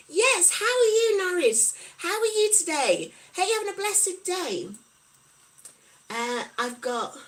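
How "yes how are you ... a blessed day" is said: bright greeting. Real, not acted. Greeting a viewer in chat.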